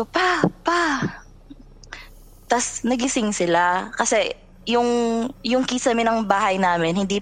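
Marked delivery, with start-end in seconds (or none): 0.0-0.8 high call for dad. Retold or imitated. Retelling calling her father at night, high mock call. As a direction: call your father from the dark room, mildly mock shout. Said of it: "sumigaw ako".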